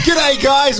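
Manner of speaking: put-on high, sloppy drunk greeting. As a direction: sing out a tipsy hello. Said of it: "At the start, you're like".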